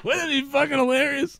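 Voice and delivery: high, laughing question; giggling delight. Real, not acted. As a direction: ask it through laughter. Laughing at own joke.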